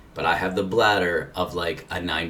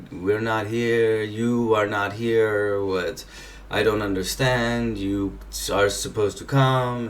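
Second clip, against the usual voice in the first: slow, higher-pitched mock German accent; fake German accent; 4 semitones higher, slower than usual. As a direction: imitate halting English with a German accent. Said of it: "my fake German accent isn't that great".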